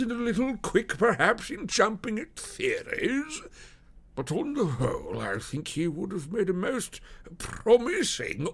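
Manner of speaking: high, slow character voice, grudgingly admiring; confiding detective, pitched well above your own; police detective, confiding admiration. Acted. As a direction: praise a colleague in a drawn-out, high-pitched officer's voice.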